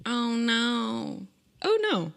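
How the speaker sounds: high, drawn-out little wail; playful dread. Real, not acted. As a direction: squeak it in comic dismay. Mock dread on reading the question.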